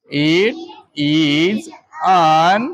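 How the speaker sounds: "It", "is", "an" sounded out separately; pronunciation demo. Shown as a demonstration. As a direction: model It... is... an, drawn out for learners. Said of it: "એનો ઉચ્ચાર કંઈક આવો થશે". (ઉચ્ચાર શીખવતાં છૂટા, લંબાવેલા શબ્દો)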